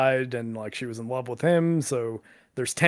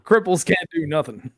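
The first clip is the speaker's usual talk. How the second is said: mock callous voice; 5 semitones higher, 7 dB louder than usual.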